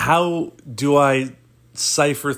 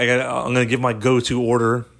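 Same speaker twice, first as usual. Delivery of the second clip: ordinary delivery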